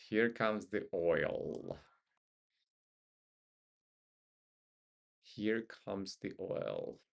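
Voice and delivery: drawn-out, dramatic here comes the oil; slow announcement. Acted. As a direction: stretch every word slowly. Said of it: "announcing dramatically, drawn out".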